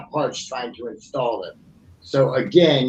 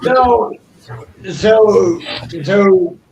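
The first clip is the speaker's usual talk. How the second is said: loud, raised repeated opener; calling for attention; 6 semitones higher, 6 dB louder, much slower than usual. Real, not acted. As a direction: call the room back, loud and higher. Calling the viewers back to attention.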